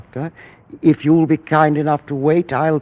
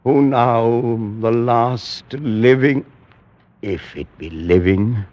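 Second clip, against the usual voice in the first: ordinary delivery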